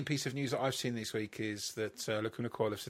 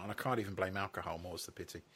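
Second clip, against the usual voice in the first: usual speaking voice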